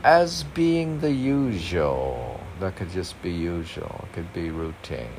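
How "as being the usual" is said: aphorism read out high and loud; reading aloud. Shown as a demonstration. Reading a saying to his partner, declaiming.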